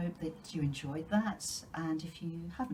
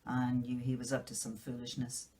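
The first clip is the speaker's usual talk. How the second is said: ordinary delivery, nothing marked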